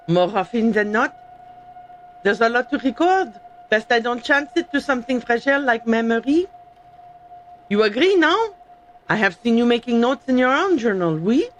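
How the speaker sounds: hammed-up French accent, reading in character; amateur mock-French accent; fake French accent. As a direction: put on a bad French accent. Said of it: "comfortable doing is the fake French".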